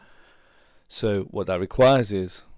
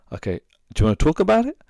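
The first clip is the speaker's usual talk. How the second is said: eager; 10 semitones higher, 8 dB louder, much faster than usual. Retold or imitated. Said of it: "I was like".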